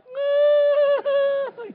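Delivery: loud, high drawn-out wail; mock panicked scream. Preacher reenacts once his son goes in, loud panicked wail. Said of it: "when he goes in, I'm like".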